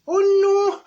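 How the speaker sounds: high loud horrified gasp of a cry; shocked cry. Retold or imitated. Fanny realising her glass eye is gone, shocked high cry. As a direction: yelp it in sudden dismay.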